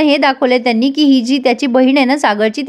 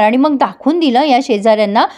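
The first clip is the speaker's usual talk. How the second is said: ordinary delivery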